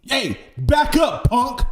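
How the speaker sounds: loud, high, punchy performed read; hammed-up tough challenge, high and loud; killing the line. Actor showing friends the line he nailed, hammed-up confrontational delivery. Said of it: "one line I can kill it".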